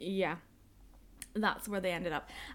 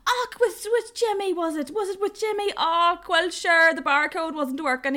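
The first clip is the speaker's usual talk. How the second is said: much higher, lilting rural Irish Och, with Jimmy; rural Irish man; 8 semitones higher, 6 dB louder than usual. Retold or imitated. Desk guy guesses the parcel went to Jimmy, high lilting rural Irish. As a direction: play a chatty local who knows everyone.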